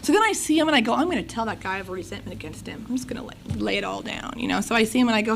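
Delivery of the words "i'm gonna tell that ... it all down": fast whisper; whispered plotting. Retold or imitated. Telling herself she'll confront him, in a fast whisper. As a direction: whisper the plan to herself, quick.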